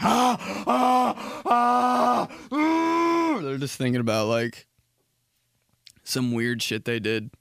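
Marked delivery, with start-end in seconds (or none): 0.0-3.4 high strained grunts under a heavy lift; high mock straining grunts. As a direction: grunt through the squat. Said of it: "and they're like".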